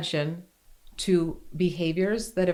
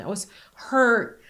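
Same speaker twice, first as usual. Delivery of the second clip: usual speaking voice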